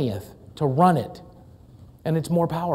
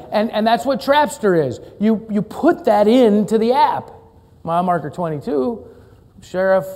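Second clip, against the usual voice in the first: ordinary talk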